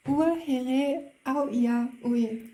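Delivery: "Ua here au ia ui" spelled out slowly and loudly, each word separate, with a German accent; slow careful Tahitian. Shown as a demonstration. Reading a Tahitian love line aloud to viewers, slow and loud with a German accent. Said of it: "I add such a strong German, um, accent".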